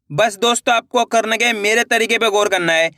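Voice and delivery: normal talking voice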